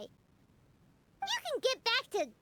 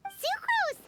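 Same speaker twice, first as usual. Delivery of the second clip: high, louder call of a name; 10 semitones higher, 3 dB louder than usual. Acted. Paimon calls out to Sucrose, high and loud. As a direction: hail her from across the room. Calling out to a friend.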